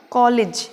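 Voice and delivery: कॉलेज ending in short "lij"; कॉलेज with clipped second vowel; corrected pronunciation. Shown as a demonstration. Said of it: "तो बनेगा".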